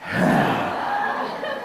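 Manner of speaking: long unvoiced sigh of disgust with a laugh. Shown as a demonstration. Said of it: "Act really disgusted".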